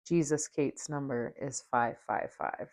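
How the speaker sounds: ordinary delivery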